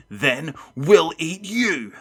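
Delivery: loud, slow, high threat; shrieking monster. A narrator's reading. Biggest monster to Matt, shrieking threat. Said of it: "the biggest one shrieked".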